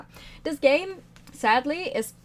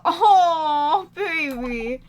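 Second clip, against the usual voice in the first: laughing cry; 4 semitones higher, 9 dB louder, much slower than usual. Real, not acted. Laughing at her own joke.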